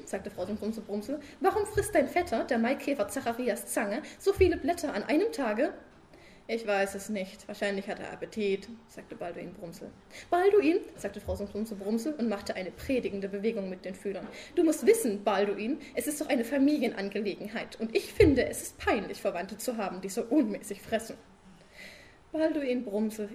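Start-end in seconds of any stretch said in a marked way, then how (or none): 1.4-5.7 high, loud wife pestering her sleepy husband; nagging wife. Acted. Frau Sumsum als Figur gesprochen. 14.6-21.2 nagging wife. Acted. Frau Sumsum als Figur gesprochen.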